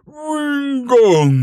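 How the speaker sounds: high, loud, slow roaring cry; roaring dragon. Acted. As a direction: roar it out like a furious dragon. Dragon roars the word back. (dragão rugindo furioso)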